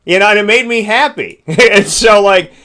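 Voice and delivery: bright laugh breaking through the words; laughing happily. Real, not acted. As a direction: giggle with real delight. Happy about sleeping dog.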